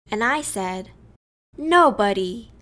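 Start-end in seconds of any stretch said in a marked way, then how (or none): 1.6-2.5 loud sulky one-word answer; defiant. A narrator's reading. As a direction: refuse it flatly.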